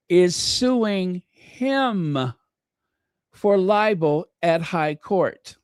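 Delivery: very slow, each word spaced out; slow careful retry. Shown as a demonstration. Retrying the Harry news for viewers, slow and deliberate. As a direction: take it again slowly and deliberately. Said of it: "let me try one more time".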